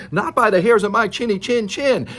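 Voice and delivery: a touch of fear in a defiant pig; scared little pig. Acted. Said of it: "was shaking and scared and said".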